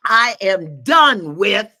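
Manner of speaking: I am done with, each word loud and drawn out; slow emphatic declaration. Real, not acted. Host to audience, slow loud proclamation. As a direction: plant the words like a decree.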